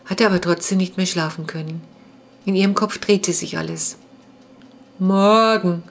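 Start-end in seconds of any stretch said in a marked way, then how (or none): 5.0-5.8 sleepy, drawn-out yawned greeting; drowsy word stretched by a yawn. A narrator's reading. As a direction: say it through a yawn. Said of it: "sagte sie und gähnte".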